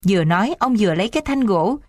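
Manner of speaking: plain reading voice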